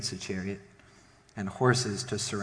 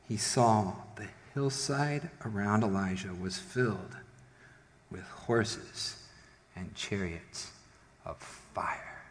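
hushed awe; 3 semitones lower, 7 dB quieter than usual. A narrator's reading. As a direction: let wonder take the voice away, pausing between phrases. Awed reveal of heavenly army.

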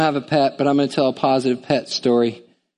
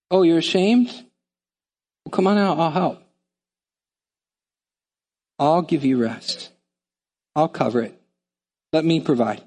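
God comforting the ashamed; gentle reassurance. Acted. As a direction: ease them out of shame tenderly.

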